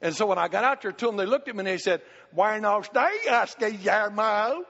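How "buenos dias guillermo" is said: slow, drawn-out mock greeting in Spanish; slow teasing Spanish greeting; kids mocking Spanish. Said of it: "They were mocking the way I talk".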